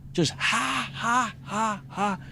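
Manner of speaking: high, breathy cartoon cackle; a silly high-pitched cartoon laugh. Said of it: "looks like a cartoon, like a cartoon laugh".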